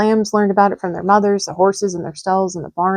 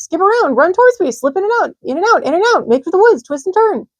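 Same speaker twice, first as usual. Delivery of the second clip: very high, loud honking goose cries; a goose barking orders to the pig; shrill-pitched urgent goose shouts; excited goose; 11 semitones higher, 4 dB louder than usual. A narrator's reading. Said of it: "cried the goose".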